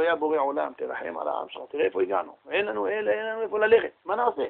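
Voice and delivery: soft, hushed pleading prayer; quiet plea to God. Acted. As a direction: murmur it up to heaven, begging. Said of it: "מרים עיניים למרום ואומר לו". (תחינה שקטה ורכה אל בורא עולם)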